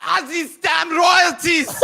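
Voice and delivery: a hammed-up shout in his father's voice; mock father shout. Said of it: "What, what would he say?".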